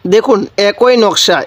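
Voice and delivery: usual speaking voice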